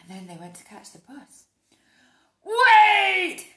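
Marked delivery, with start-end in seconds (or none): 2.4-3.5 very loud high bellow. Acted. Mister Brown bellowing at the bus. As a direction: bellow it after them. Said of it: "bellowed Mister Brown".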